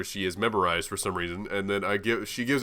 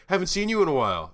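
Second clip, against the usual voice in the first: mock server voice; 8 semitones higher, faster than usual. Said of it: "she's like".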